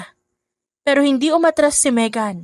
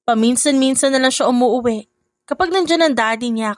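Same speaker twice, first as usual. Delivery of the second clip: plain reading voice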